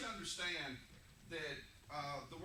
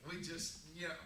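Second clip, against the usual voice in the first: ordinary delivery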